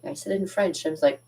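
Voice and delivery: ordinary talk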